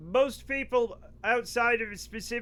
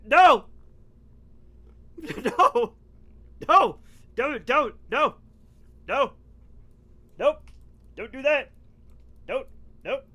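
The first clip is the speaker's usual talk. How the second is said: loud, high, repeated no's; urgent shouts to stop someone at once; sharp scolding shouts; 5 semitones higher, 8 dB louder than usual. Real, not acted. Cat owner to misbehaving cat, loud scolding.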